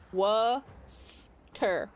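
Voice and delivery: Worcester drawn out very slowly as WUSS-ter. Shown as a demonstration. Spelling it out slowly for viewers, Worcester as WUSS-ter. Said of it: "that's how this is pronounced".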